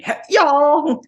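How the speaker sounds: very high, loud, drawn-out Southern "y'all". Shown as a demonstration. Podcaster demoing a wild way to say "y'all", very high and loud. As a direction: belt out "y'all" like a Southern yell. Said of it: "you can say".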